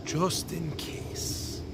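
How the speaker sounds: slow, hushed Irish brogue, dying away. Retold or imitated. Great aunt's answer echoed, fading slow Irish brogue. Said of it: "in her thick Irish brogue".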